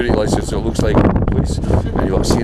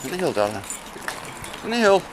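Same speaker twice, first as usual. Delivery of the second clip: higher coaxing call to the dog; high-pitched dog command; 6 semitones higher, 7 dB quieter, faster than usual. Real, not acted. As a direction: lift the voice to coax her in.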